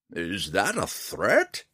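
put-on slow, wary old hermit; old man voice. A narrator's reading. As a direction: ask warily, slowly. Old man Montaleo asks.

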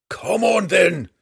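a high, loud battle roar; stubborn dwarf roaring a dare. Acted. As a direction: bellow the challenge at the monster. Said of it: "the stubborn dwarf roared".

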